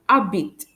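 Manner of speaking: Habite said "a-beet", no H; silent H. Shown as a demonstration. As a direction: start Habite straight on the vowel. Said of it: "I don't pronounce the letter".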